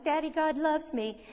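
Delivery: high-pitched ooey-gooey imitation; mock sentimental. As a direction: lightly parody childlike sentimentality, high in pitch. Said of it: "this little sentimental statement".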